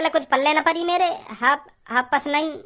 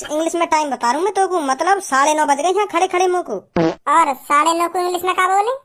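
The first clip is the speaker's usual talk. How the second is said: ordinary talk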